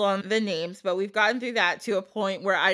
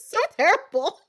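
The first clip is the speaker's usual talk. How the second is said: laughing dismay; 11 semitones higher than usual. Real, not acted. Laughing over the lamb twist.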